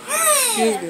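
said through a rising yawn; mid-yawn. Real, not acted. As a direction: let the yawn stretch the words. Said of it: "let me yawn first".

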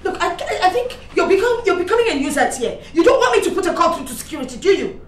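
mocking dismissal. Mother mocks son dismissively.

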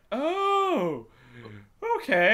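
high, loud relieved gasp of 'oh, okay'; acted relief. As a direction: play it big and relieved, voice jumping up. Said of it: "and it's like".

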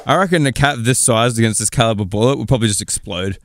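ordinary talk, nothing marked

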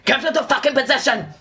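high-pitched yelled game order; hammed-up battlefield order; mock shouted command. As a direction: yell it high to annoy the team. Re-enacting annoying game callouts.

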